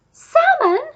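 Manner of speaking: high, loud bird shriek; screech. A narrator's reading. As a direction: screech it in disbelief. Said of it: "screeched the pelican".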